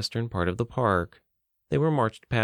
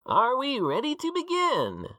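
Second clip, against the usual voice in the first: showman announcer. A narrator's reading. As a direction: greet the crowd like a cheery emcee. Mickey addresses crowd.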